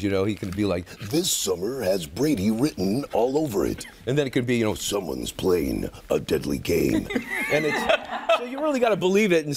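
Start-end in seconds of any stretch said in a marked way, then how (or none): 1.0-3.9 slow deep trailer voice; low, slow announcer voice; trailer announcer inflection. Retold or imitated. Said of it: "He has different inflections". 4.7-7.1 very low, slow trailer announcer; deadly trailer voice. Retold or imitated. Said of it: "He has different inflections".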